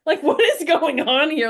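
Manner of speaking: baffled and amused at her own feelings; laughing bewilderment. Retold or imitated.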